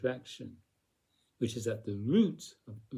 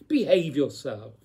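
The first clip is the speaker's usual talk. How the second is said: louder, clipped reprimand; stern reprimand; 7 dB louder than usual. Retold or imitated. Teacher voices a stern adult reprimanding a child, louder. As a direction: order them to behave. Quoting scolding others.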